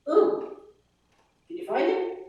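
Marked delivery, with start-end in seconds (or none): 0.1-0.5 high "Ooh" placed far back by the uvula. Shown as a demonstration. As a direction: hoot the ooh from the back of the mouth. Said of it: "is an oo".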